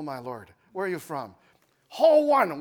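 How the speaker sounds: ordinary talk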